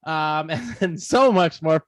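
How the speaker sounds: ordinary talk, nothing marked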